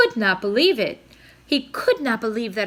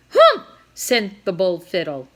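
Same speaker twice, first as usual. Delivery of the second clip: usual speaking voice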